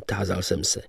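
usual speaking voice

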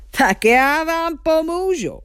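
high, slow giant's holler; storybook giant calling mockingly; amused giant. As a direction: taunt them in a stretched-out giant voice. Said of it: "zasmál se obr".

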